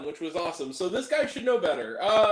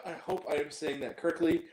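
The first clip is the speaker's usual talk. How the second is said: ordinary talk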